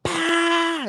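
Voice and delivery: a sharp high "Pa!" like a shocked dealer; high mock exclamation. Retelling the Colombian dealer's reaction, high startled yelp. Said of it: "he's like".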